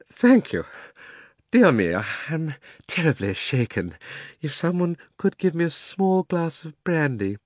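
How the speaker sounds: put-on fussy, high, shaken old man; a bruised gent begging for brandy; shaken old gentleman. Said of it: "in a faint voice".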